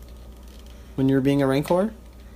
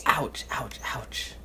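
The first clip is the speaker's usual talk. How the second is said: whispering; 6 dB quieter, much slower than usual. Acted. Father whispers mock pain.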